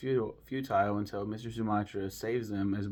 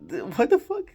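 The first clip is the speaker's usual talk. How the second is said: loud, much higher imitated exclamation; mock outraged outburst; 13 semitones higher, 9 dB louder than usual. Character's line between bursts of score, loud mock outrage.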